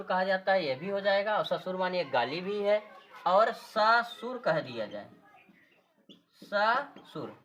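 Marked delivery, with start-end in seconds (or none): 3.5-4.4 ससुर in two parts, lengthened स, then सुर; split word. Shown as a demonstration. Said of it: "ससुर मतलब सुर सहित". 6.4-7.3 ससुर with a long स, a break, then सुर; split word. Shown as a demonstration. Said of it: "ससुर मतलब सुर सहित".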